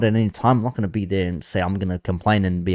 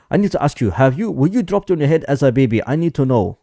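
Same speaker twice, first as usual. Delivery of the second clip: attempted African accent; 5 semitones higher, 4 dB louder than usual. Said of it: "I can't do an, uh, accent, uh, African accent".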